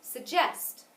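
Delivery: light Sug, punched gest in Suggest; accent the second syllable of Suggest; stress on second syllable. Shown as a demonstration. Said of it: "second syllable as well".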